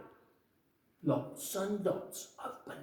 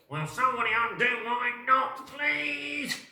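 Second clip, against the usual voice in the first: pleading elephant; 11 semitones higher, 7 dB louder than usual. Acted.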